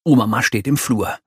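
usual speaking voice

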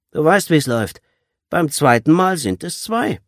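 hard ringleader's warning; menacing. A narrator's reading. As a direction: lay down the penalty to a captive. Leader threatens the bound captive.

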